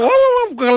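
very high imitated yaps; dog bark imitation. Shown as a demonstration. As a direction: yap like the little dog, way up high. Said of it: "αλλά ο άλλος ο μεγάλος". (πολύ ψιλό γάβγισμα, μίμηση σκύλου)

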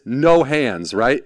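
ordinary talk, nothing marked

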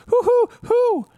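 high hooting like owls; mimicking owls in the woods; owl hoots. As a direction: hoot nonstop like owls. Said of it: "they're going nonstop".

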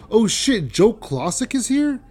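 retold starstruck disbelief, high and loud; excited surprise. Comic reacting to news about the headliner, excited surprise. Learns his idol is there.

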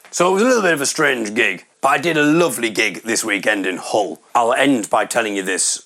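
ordinary talk, nothing marked

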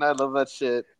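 normal talking voice

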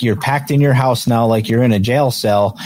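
ordinary delivery

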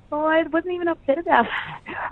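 a hint of an airy brush-off; mock protest. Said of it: "'cause you're like".

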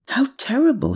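high-pitched, understated shock; gasped dismay. A narrator's reading. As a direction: react aghast, pitched well up. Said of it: "she gasped".

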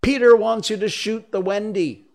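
high, loud fairy order; ringing fairy voice. Acted. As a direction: ring it out high and urgent. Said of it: "Tink's reply rang out".

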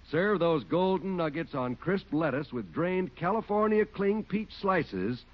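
ordinary talk, nothing marked

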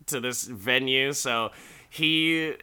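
ordinary talk

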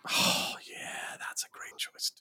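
hushed, breathy aside; whisper. Real, not acted. Cohost whispering agreement about Danny Kaye casting. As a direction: whisper it like a shared secret.

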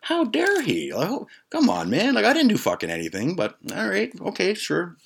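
very high, hammed-up indignation; mock offended. Friends outside griping about the host, mock offended. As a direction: exaggerate being wounded, pitch way up. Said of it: "how truly offended we were".

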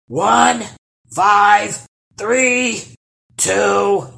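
loud, high, called-out count; troll counting aloud. A narrator's reading. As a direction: bellow each number as the seeker. Troll counts aloud for hide-and-seek.

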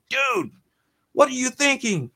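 high, squeaky disbelief; mock incredulous whine. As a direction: whine it like a baffled teen. Mocking coworker's ticket choice.

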